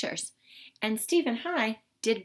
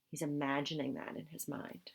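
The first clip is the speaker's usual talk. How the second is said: whisper; 5 semitones lower, 10 dB quieter than usual. Real, not acted. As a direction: share it like a secret. Aside to children about picture.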